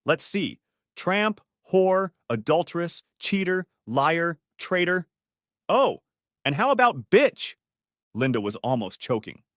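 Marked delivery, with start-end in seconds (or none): 0.1-7.6 higher, scornful name-calling; contemptuous insults. Acted. Daughter insulting her mother, higher scornful voice. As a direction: spit each insult at her.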